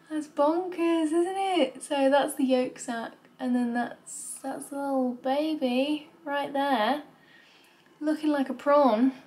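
thrilled pointing out the scan; excited. Real, not acted. Amazed at the scan picture.

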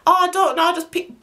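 high, breezy, shrugging reply; lightly voiced careless friend; airy carefree friend. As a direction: toss it off like it's no big deal.

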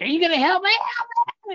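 high-pitched mock plea, climbing to a squeal; imitated screaming victim. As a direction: act out a haunt victim begging for help. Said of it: "And started screaming".

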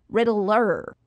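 loud, clipped name fix; indignant correction. A narrator's reading. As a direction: Riddler insists the burglar use his name, loud. Riddler corrects his name.

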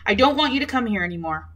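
ordinary delivery, nothing marked

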